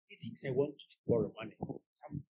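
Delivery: usual speaking voice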